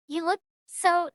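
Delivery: usual speaking voice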